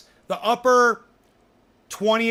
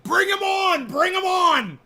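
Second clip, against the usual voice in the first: excited shout; 10 semitones higher, 6 dB louder, slower than usual. Real, not acted. Excited invitation to a podcast guest.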